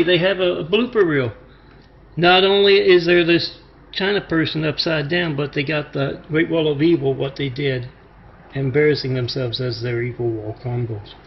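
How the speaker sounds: ordinary talk, nothing marked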